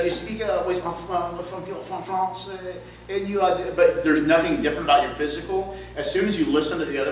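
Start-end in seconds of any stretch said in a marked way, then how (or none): 0.0-3.6 halting mock French accent. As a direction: fumble through a put-on French accent. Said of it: "I can do a French accent".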